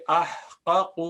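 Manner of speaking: احقق spelled out slowly as ah-qa-qu; slow demonstration of احقق, no doubling; original unmerged form. Shown as a demonstration. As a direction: separate the two qafs in احقق. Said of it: "اصل میں تھا احقق".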